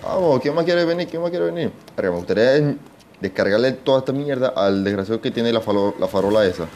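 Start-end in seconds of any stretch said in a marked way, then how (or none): 0.0-1.7 high, bold taunt at the horde; taunting zombies. Real, not acted. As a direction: dare them to come at you, pitched up. Taunting zombies mid-fight.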